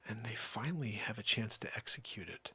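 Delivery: hushed whisper, letting them in on a secret; conspiratorial whisper. Real, not acted. Host to his audience, revealing the elite plan in a whisper. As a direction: breathe it like a dangerous secret.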